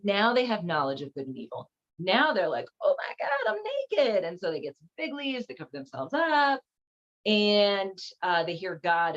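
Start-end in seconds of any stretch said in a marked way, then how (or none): 2.8-4.2 high-pitched, hammed-up dismay; mock shock. Adam and Eve discovering they are naked, mock shock. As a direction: squeak it out as a sudden realisation. Voices Adam and Eve's realisation.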